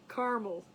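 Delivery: Caramel as CAR-mul, middle A dropped; two-syllable caramel. Shown as a demonstration. Host toying with viewers' version, Caramel as CAR-mul. Playing with the A-less pronunciation.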